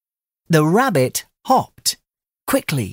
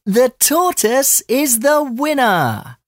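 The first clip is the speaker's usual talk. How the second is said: excited cheer; 11 semitones higher than usual. Acted. Animals cheer the tortoise.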